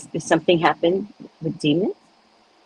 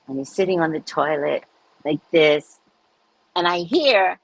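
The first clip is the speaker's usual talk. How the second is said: ordinary talk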